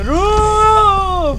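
high, drawn-out scared yell; scared horror game streamer. Real, not acted. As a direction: cry out slow and high in fright.

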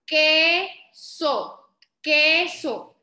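"Queso" split into KE... SO; teacher's deliberate "Queso", first syllable held. Shown as a demonstration. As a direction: sound out "Queso" in two stretched beats. Teacher sounds out word by syllables.

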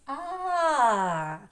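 а held long with the mouth wide open; open а sound. Shown as a demonstration. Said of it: "Рот широко відкрився". (протяжне, відкрите «а»)

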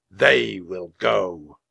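slow, weighty, projected verdict; measured knightly decree; slow pronouncement. A narrator's reading. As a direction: draw out each word solemnly. Knight chooses children, solemn slow verdict.

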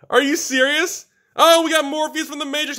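high, loud squeal of disbelief; incredulous excitement. Real, not acted. Card opener who cannot believe the pull, high excited shout. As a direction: cry it out, voice way up.

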